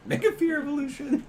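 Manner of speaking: high, loud announcer call; hype announcer voice. Pretend. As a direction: announce it like an anime move. Hammy announcer repeat.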